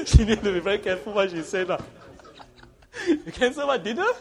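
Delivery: laughing through the words. Real, not acted. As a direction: talk while laughing hard. Laughing while talking.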